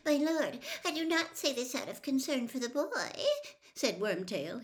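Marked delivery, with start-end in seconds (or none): 0.1-3.1 high, hurried, pleading servant; squeaky rising voice. A narrator's reading. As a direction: squeak out a protest to your master. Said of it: "his voice rising squeakily".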